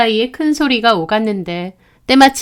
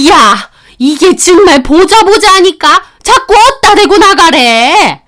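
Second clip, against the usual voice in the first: much higher and louder, shrieking in rage; furious shout; 7 semitones higher, 6 dB louder than usual. Acted. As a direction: explode at being told to leave. Mother-in-law yelling at daughter-in-law. (악을 쓰는 시어머니)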